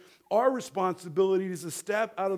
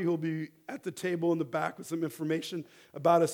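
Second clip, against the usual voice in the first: usual speaking voice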